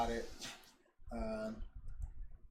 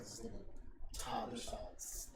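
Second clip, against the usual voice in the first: slow whispered aside; 3 semitones lower, 7 dB quieter, slower than usual. Real, not acted. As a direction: whisper it slowly for effect. Whispered detail.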